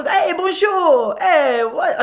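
high, drawn-out mock French; hammed-up French greeting, slow and high; mimicked French accent. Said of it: "it's okay to mimic some accents".

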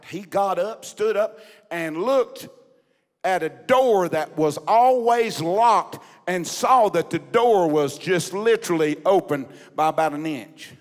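loud, emphatic revival storytelling; impassioned preaching. Acted. Preacher telling church of a pastor's prison escape, impassioned. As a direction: preach the suspense with punched key words.

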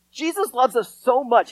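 usual speaking voice

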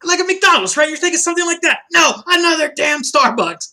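loud, high comedian's rant; exasperated stand-up tirade, shouted. Retold or imitated. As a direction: imitate an outraged comic, building to a shout.

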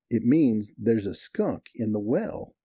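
very slow, spelled-out restatement; deliberate explanation. Retold or imitated. Cotton explains to the puzzled manager, slow and deliberate. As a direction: say it slowly, as if to someone slow to catch on.

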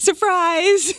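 high, excited squeal; spring the surprise in a high voice; excited reveal. Real, not acted. Revealing birthday concert tickets, excited.

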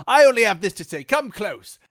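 put-on high theatrical fair accent; mock Renaissance showman's accent. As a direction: beckon in a stagey performer's accent. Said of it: "he drops the accent".

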